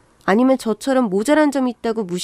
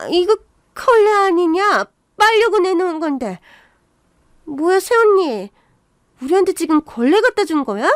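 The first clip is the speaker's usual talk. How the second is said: put-on higher-pitched, indignant young in-law; irritated sister-in-law; 7 semitones higher than usual. Sister-in-law accusing her brother's wife over a rag, indignant.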